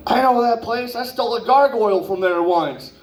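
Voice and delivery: a lightly voiced girl on the phone. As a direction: play her casually bragging. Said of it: "you could hear her over the phone".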